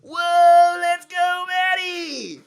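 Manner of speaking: high, very loud whoop of support; full-out high-pitched cheer; cheering shout. Acted. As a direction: yell it out like a fan in the crowd. Cheering for Maddie in the story.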